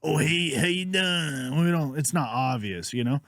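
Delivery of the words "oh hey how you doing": slow, stretched-out mock Southern drawl. Shown as a demonstration. Showing how Northwesterners don't talk, mock Southern drawl. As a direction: exaggerate a Southern drawl, drag the vowels. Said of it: "like, a Southern drawl".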